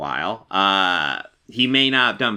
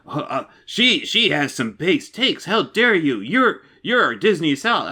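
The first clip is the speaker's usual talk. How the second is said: slow, higher, stammering mock outrage; mock triggered fan; 4 semitones higher, slower than usual. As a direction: stutter out a parody of an offended fan. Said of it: "Just like".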